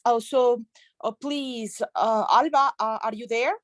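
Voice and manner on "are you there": ordinary talk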